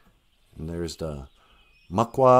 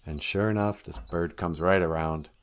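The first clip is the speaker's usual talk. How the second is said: usual speaking voice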